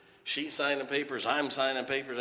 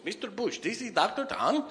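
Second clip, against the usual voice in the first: much higher-pitched mock Chinese accent; broken Chinese accent; 10 semitones higher than usual. Pastor mimicking Chinese doctor on the phone, high broken Chinese accent. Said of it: "in his broken Chinese voice".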